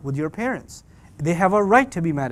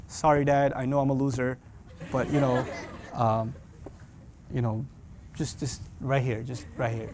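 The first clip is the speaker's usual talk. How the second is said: mock sheepish apology, fading softer; 4 semitones lower, 7 dB quieter than usual. Teen apologising to dad, softly fading off. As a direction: mumble a sorry to dad, dropping away. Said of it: "hugging dad, apologising".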